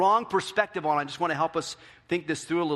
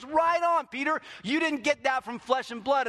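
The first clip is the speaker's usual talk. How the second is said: much higher, breezy praise; casual approving Jesus; 10 semitones higher than usual. Retold or imitated. Jesus praises Peter, high and breezy. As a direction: cheer him on like a pleased coach.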